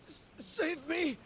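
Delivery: high, desperate pleading; begging to be saved in panic; frightened cry for help. Acted. Brother in peril begs for rescue, panicked.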